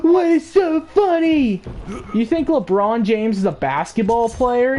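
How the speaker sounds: high, affronted put-on voice; mock indignant whine. Kid challenges the laughing cop, mock indignant whine. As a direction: squeal it, offended at being laughed at.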